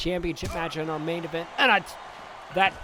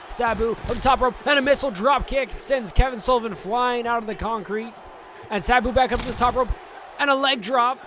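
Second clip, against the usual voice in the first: excited play-by-play; 4 semitones higher than usual. Acted. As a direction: shout the big moves as they land. Live wrestling play-by-play.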